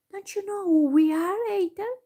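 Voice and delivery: put-on high, loud girl voice; girl's question. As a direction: ask it as a bright young girl. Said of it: "questioned the girl".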